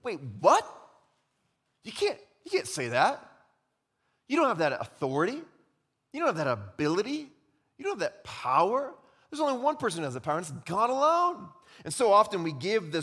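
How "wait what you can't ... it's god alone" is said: indignant scribes. Pretend. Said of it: "the scribes begin to think".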